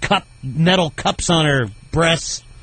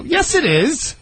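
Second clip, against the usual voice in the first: mock innocent; 12 semitones higher, slower than usual. Joking with airport desk clerk.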